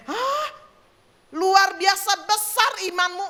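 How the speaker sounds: high gasped "hah", then loud wonder; loud, high-pitched imitation of amazement; amazed exclamation. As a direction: act out astonishment at what you see. Acting Jesus amazed at faith.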